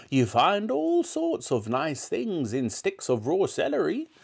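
put-on high old-woman voice; relishing grandmother. A narrator's reading. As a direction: savour the treat as a horrid granny. Grandmother relishing disgusting food.

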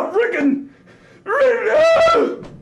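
frustrated yelp, pitch shooting up; comic fed-up squeal; exasperated outburst. Acted. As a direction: break off into a high cry of frustration. Exasperated rant about the toilet.

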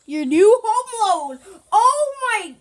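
loud, high, drawn-out shocked yell; shocked outburst. Real, not acted. Player yelling at the game over his new loan, shocked and loud. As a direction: blurt it out as the bill lands.

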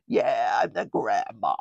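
deep mocking smoker-grandmother voice; smoker grandma. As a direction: drop low to mimic the grandma. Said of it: "That's how she talked".

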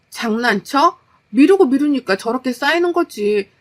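heated, mounting exasperation; angry wife. Acted. Overworked wife telling husband to stop making mess, angry. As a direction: push harder to a loud final demand.

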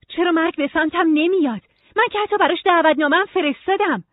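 high, loud, indignant complaint; protesting Nora. Acted. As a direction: protest as if unfairly refused.

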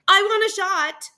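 put-on high, loud kid's demand; eager little boy. Boy eager for a second dinosaur, demanding loudly. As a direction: blurt it out like an excited child.

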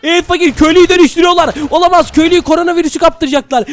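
alarmed cry. Acted. Player warning his friend the villager is being turned, alarmed cry. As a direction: raise the pitch in urgent alarm.

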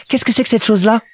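put-on high, questioning little-boy voice; curious Petit Prince. A narrator's reading. Little prince asks about the plane, high and curious. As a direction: ask like a small child, pitched high.

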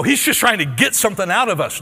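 preacher mimicking a suspicious listener; emphatic rebuttal. Real, not acted. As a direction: call out the doubters. Voicing skeptical congregation.

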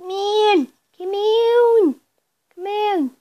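high, taunting call. Real, not acted. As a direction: beckon the enemy in. Calling enemies in.

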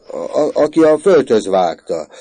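stammered blurt, high and loud; flustered. A narrator's reading. Confused little boy answering the woman, flustered. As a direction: stumble into it, then burst out loudly. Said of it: "zavarba magyarázva".

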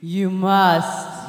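loud, firm command; booming spirit's order. Acted. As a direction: override his plea with force. Ghost overrules Scrooge's refusal.

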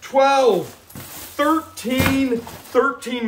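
high, drawn-out triumphant tally; high-pitched celebratory count; excited counting. Real, not acted. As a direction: call out each number with mounting thrill. Building count of donated bags.

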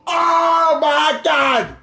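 slow, drawn-out cry of disbelief; anguished shout. Real, not acted. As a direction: wail at the loss. Losing the match.

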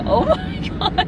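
delighted squeal at a puppy; high gushing oh my gosh; excited. Real, not acted. Cute bulldog puppy crossing.

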